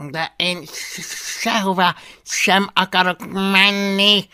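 high, loud creature voice; dragon arguing back. A narrator's reading. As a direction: argue stubbornly as a little dragon. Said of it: "szintén higgadtan".

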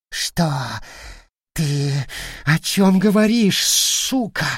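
panicked, rising voice; voice cracking into squeal. Acted. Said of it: "голос вампира срывался на визг".

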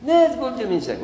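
high-pitched objection to "دو ميل سانك"; mock protest. Teacher rejects the French number, high protesting voice. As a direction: scold with the pitch leaping up.

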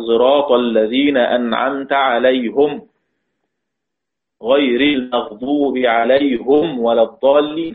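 measured recital, عليهم as "alayhum"; Hamza's recitation. Shown as a demonstration. Teacher demonstrating Hamza's reading to students, عليهم as "alayhum". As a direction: recite the verse with "-hum" ending each عليهم. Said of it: "يقرأ حمزة".

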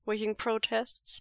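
plain reading voice

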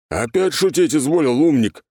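high, loud menacing anger; furious at the joker; threatening snarl. A narrator's reading. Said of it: "угрожающе сдвинул брови". (угрожающе, яростно)

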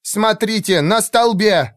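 high, urgent cry of alarm; shouted warning. A narrator's reading. Elf archer points out danger on the pillar, urgent shout. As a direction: call out what you have spotted. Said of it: "крикнула Валира".

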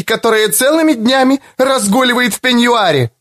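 put-on mother driving the girl off; high angry mother's tirade; furious mother. A narrator's reading. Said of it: "потоком гнева и боли".

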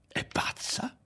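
whispered, incredulous inner question; wondering to himself. A narrator's reading. Ario privately questioning the woman's sanity, whispered wondering. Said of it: "si domandava Ario".

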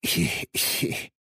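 breathless, nervous little titter; nervous mumbled giggle. A narrator's reading. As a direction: mutter a shaky giggle under your breath. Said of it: "смог пробормотать". (нервное бормотание-смешок)

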